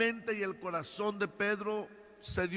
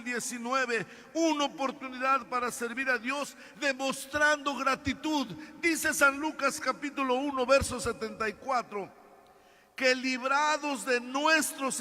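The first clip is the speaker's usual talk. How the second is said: preacher exhorting the congregation; preaching; 5 semitones higher than usual. Acted. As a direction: drive the message home. Preaching at full force.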